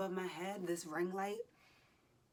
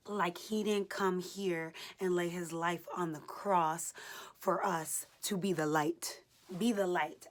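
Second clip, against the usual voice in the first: preaching; 5 dB louder than usual. Real, not acted. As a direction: preach it with full force. Fervent preaching during workout.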